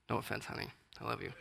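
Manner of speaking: soft whispered aside to his wife; hushed teasing aside to honey. Real, not acted. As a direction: drop to a whisper for a quick apology.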